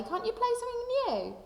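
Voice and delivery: put-on high, teasing kid voice; teasing friends. Friends teasing the kid about practising the same song, high nagging voice. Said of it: "My friends will say".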